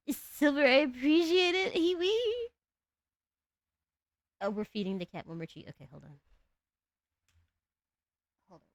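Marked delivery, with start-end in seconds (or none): 0.1-2.5 high, loud squealed cringe voice. Pretend. Streamer thanking Silver in high, squealed cringe voice. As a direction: squeal it, climbing higher at the end. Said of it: "to do my cringe voice".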